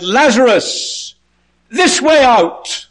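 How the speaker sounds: high, long-drawn shout; calling out. Acted. Jesus outside the tomb to Lazarus, high drawn-out call. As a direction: summon someone from far off, slow and very high. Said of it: "stood outside the tomb and he said".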